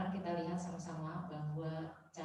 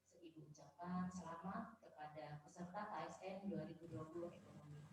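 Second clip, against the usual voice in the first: faint voice, too far from the mic; speaking too softly to be heard; barely audible; 9 dB quieter than usual. Real, not acted. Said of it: "Suaranya terlalu kecil".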